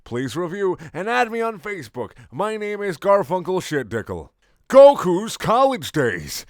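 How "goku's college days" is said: slow title announcement. Acted. Title read out.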